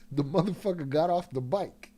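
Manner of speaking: ordinary talk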